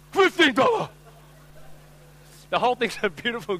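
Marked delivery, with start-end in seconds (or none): 0.1-0.9 loud, high-pitched mock shock; hammed-up outraged shopkeeper; shocked indignation. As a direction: squawk in disbelief at a lowball offer. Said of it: "the same way".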